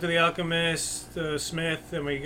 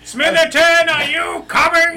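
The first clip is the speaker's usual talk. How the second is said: calling out; 11 semitones higher, 7 dB louder, slower than usual. Acted. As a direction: call down the hallway, loud. Calling out down hall.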